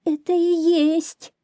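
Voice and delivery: high, slow, pitiful little voice; plaintive hare. A narrator's reading. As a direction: whimper the confession as a hare. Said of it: "жалобно сказал заяц". (заяц говорит жалобно)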